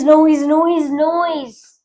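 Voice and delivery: usual speaking voice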